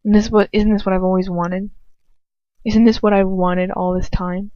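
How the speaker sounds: plain reading voice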